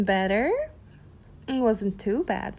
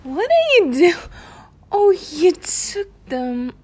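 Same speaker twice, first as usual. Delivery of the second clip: much louder, higher surprised cry; surprised outcry; 7 semitones higher, 9 dB louder than usual. Acted. Startled when the listener grabs her, loud surprised cry. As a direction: caught off guard.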